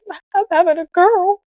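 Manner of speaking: high-pitched mock-choked-up husband; retold teary announcement, voice way up; tearful imitation. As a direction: imitate him overcome with happy tears, pitched high. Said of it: "He's got tears rolling down his eyes".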